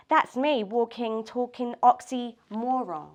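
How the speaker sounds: deliberate, emphatic poetry reading; measured lines with punched key words; spoken-word poem. Acted. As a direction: perform your own confessional poem to a small room. Reads own poem aloud.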